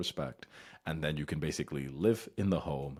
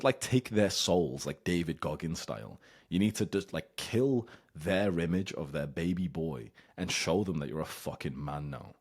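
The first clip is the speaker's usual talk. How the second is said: normal talking voice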